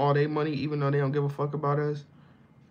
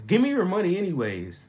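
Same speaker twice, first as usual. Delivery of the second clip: higher, louder mock demand; 7 semitones higher, 4 dB louder than usual. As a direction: bark it out like a greedy friend. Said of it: "But then he says".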